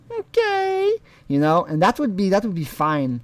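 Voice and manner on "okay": high, drawn-out little okay; high mock Kayla. Mocking Kayla's automatic replies to the discussion, high mock voice. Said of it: "Kayla could really say something like".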